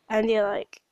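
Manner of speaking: usual speaking voice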